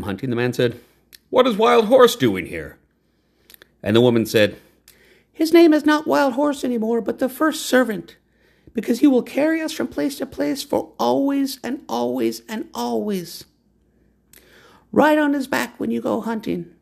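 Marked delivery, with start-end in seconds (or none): 1.3-2.8 man's voice. A narrator's reading. Man's question, voiced high. 5.3-16.7 high, proud woman's voice; lofty woman naming the horse. A narrator's reading.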